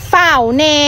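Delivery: ordinary delivery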